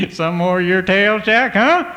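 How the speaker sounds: high, loud mocking parent voice; teasing. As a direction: parents scoffing at Jack's tale. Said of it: "they'd just say".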